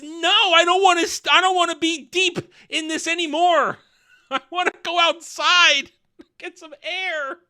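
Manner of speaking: high, loud petulant protest; hammed-up whining refusal; Celebrimbor. Smith pushing away Sauron to get air, mock tantrum. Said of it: "Celebrimbor actually pushes him, says".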